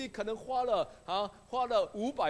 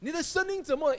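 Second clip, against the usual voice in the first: higher and louder, the realization hits; surprised; 7 semitones higher, 5 dB louder, faster than usual. Retold or imitated. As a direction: ask it again in sudden astonishment.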